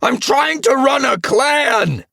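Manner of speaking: very high, loud yell; outraged full-force shout; furious shout. A narrator's reading. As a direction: scream it in fury, drawn out. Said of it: "Splashtail was furious".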